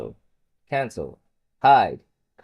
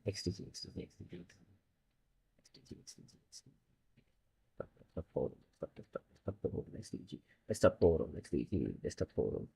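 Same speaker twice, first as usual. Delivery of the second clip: unvoiced mutter fading to near silence; whispered muttering; 8 semitones lower, 15 dB quieter, much faster than usual. Real, not acted. Frustrated coder muttering at his broken setup, whispered. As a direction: mumble it under your breath at the screen.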